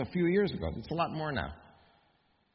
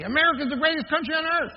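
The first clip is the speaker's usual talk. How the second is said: retold yell; 10 semitones higher than usual. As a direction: call it out across the counter, proud and pitched up. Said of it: "he yells out at me".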